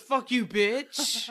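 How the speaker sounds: drawn-out, slow retort; mock dog yell. Owner voicing Briggs yelling back at her, slow mock yell. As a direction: imitate a yelling dog, words stretched out slowly. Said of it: "when Briggs yells at something".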